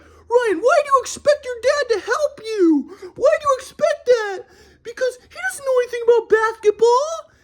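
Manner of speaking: put-on very high, crying old grandpa voice; mock grandpa. Grandson imitates grandpa scolding him, very high and teary. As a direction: whine it like a teary old man.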